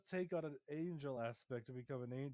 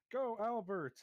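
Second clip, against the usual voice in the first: cheering; 8 semitones higher, 4 dB louder than usual. Acted. Cheering on a pet rabbit.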